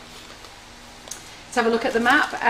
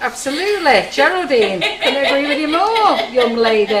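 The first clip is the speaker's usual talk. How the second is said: mock teacher; 5 semitones higher, 6 dB louder than usual. Mock scolding agreeing with viewer.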